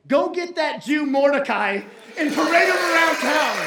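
loud, high, triumphant proclamation; booming royal command. Acted. King ordering the villain to honor his rival, loud command. King's order, acted as the punchline.

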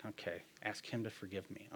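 reluctant mutter. Retold or imitated. Repeating the friend's advice back, grudging soft mutter. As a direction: grudgingly echo the advice to yourself.

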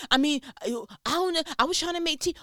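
high, hurried imitation of a defensive husband; mock flustered excuse. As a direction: parody someone stammering out excuses. Mimicking husband's excuses in the quarrel.

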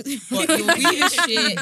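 slow, loud and high mocking jeer; mocking taunt. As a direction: sneer it out slowly like a taunt. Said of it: "girls in London were like".

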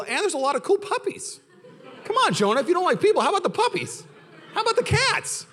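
a grinning, high, pleading divine nudge; playful God. Retold or imitated. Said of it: "God's like".

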